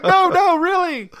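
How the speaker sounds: very high, loud playful protest; mock protesting. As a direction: argue it in a hammed-up squeal. Acted argument over a fake accent.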